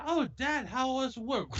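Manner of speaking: mock sweet son. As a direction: play the sugary good son. Parodying sweet son greeting.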